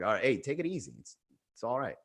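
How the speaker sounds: soft, low-key placating; hushed, careful reassurance; calming her down. Retold or imitated. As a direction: ease her down quietly. Calming down an angry woman.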